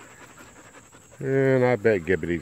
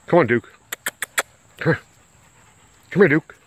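calling the dog; 5 dB louder than usual. Real, not acted. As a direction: call him over across the yard.

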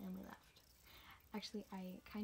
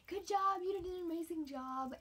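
higher, louder congratulation; cheering praise; 9 semitones higher, 5 dB louder than usual. Retold or imitated. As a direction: sell it like a proud coach. Coach praises her at finish.

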